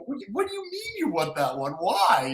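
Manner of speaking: high, quick incredulous retort; baffled protest. Retold or imitated. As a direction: act out disbelief, pitch jumping up. His baffled reply, acted out.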